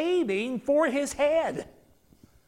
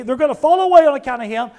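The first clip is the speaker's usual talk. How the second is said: ordinary talk, nothing marked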